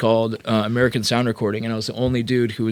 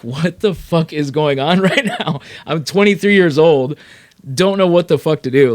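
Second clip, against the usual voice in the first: louder, higher, overwhelmed exclamation; retold disbelief; 5 semitones higher, 7 dB louder than usual. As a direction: relive being young and stunned, voice raised. Retold shock at famous clients.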